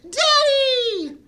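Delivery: very high, loud delighted squeal; squealing Daddy. Acted. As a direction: squeal for your dad like a little girl. Bubbles shouts to father.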